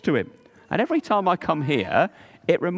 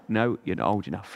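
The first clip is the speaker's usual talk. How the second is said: lower, softer fatherly refusal; stern dad; 8 semitones lower, 5 dB quieter than usual. Acted. The father refusing Carlos, low calm voice. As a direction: turn him down like a firm father.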